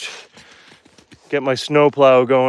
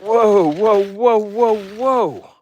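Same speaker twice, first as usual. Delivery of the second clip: alarmed whoas; 8 semitones higher, much slower than usual. Real, not acted. Losing balance going downhill.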